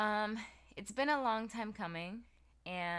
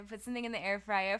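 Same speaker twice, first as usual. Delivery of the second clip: ordinary talk, nothing marked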